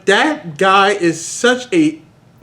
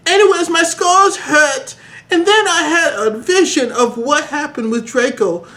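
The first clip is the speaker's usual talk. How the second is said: high whiny hero voice; 8 semitones higher than usual. Acted. Voicing Harry, continued.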